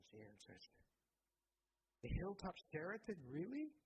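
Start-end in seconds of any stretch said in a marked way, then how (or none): 2.0-3.7 high, incredulous echo; surprised. Acted. Son echoing his mother's answer, high and incredulous. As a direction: echo it back in disbelief, rising high. Son amazed at hotel in town.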